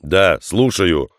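ordinary delivery, nothing marked

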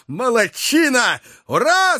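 very high, uncontainable cheer; jubilant shout. A narrator's reading. Hunter cheering Joe on, unable to hold back, jubilant shout. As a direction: cheer wildly, stretching the words out. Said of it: "закричал охотник, не в силах более сдерживаться".

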